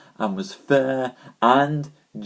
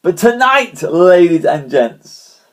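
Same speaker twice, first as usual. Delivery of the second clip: slow, louder announcer call, pitched high; grand announcer; 6 semitones higher, 7 dB louder than usual. Acted. Host calling viewers to tonight's show, grand announcer style. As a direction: roll it out like a ringmaster.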